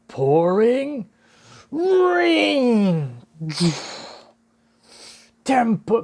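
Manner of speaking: high, drawn-out battle cry; calling out an attack. Acted. Nami unleashing her rain move, calling out the attack. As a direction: stretch each word out like a special move. Calls out Nami's attack name.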